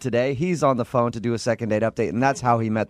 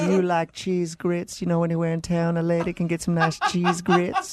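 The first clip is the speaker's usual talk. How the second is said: put-on Southern accent; 5 semitones higher than usual. Host playing Brittany with a mock Southern accent. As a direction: lay the Southern charm on thick. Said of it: "really cute Southern accent".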